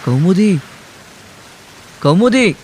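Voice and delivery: loud, high, alarmed shout of the name; shouting her name. A narrator's reading. Man shouting for his silent love in the storm. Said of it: "तो जोराने ओरडला".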